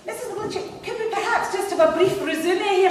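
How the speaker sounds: high-pitched, frantically polite posh receptionist; put-on posh, pitched right up; posh phone voice. Receptionist putting the caller on hold, high and posh. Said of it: "I decided I'd be rather posh".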